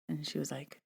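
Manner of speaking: ordinary delivery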